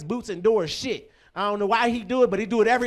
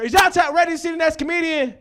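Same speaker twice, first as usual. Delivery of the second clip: raised, high-pitched hype call; crowd-rousing shout; 6 semitones higher, 4 dB louder, faster than usual. Real, not acted. Host wanting louder applause for next comedian, rousing shout. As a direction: whip the audience up with a high shout.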